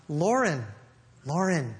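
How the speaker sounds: a hushed, patient teacher asking calmly; gentle teacher rebuke. Retold or imitated. Teacher gently questioning a pupil after class. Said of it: "this is how the teacher corrected him".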